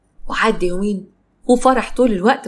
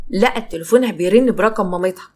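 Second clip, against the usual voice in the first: usual speaking voice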